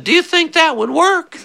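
put-on very high, squeaky little-kid question; little brother voice. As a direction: put on a tiny, high child's voice. Quoting the younger brother as a child.

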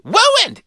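"Wooined" very high with a w; squeaky toddler "Wooined"; says w for r. Retold or imitated. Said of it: "He sounded like Elmer Fudd".

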